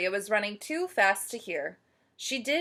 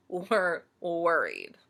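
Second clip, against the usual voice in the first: plain reading voice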